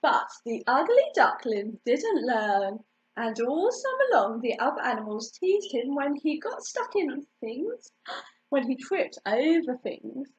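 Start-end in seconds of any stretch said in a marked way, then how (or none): none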